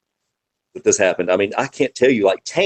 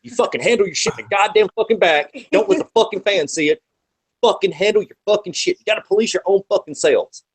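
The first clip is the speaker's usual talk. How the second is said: higher, gruff lecture about handling it backstage; fired-up old-school scolding; 8 semitones higher than usual. Retold or imitated. Veteran wrestler scolding the locker room, higher-pitched and fired-up. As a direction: growl it like a veteran laying down the law. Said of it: "He's like".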